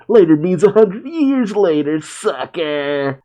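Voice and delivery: high, jeering cartoon taunt; put-on high voice, rubbing it in; mocking taunt. A narrator's reading. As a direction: gloat as you ride off with the prize. Acting a taunt shouted while riding away.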